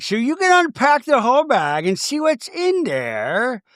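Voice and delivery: high, hammed-up instruction; mock grand hostess. Castmate urging her to unpack the bag, theatrical. Acted gift instruction, repeated.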